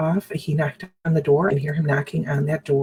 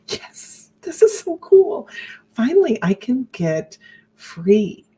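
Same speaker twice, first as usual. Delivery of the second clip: inner cheer, higher and slow; secret excitement; 6 semitones higher, slower than usual. Retold or imitated. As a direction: relish the thought of getting free. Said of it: "I was so excited".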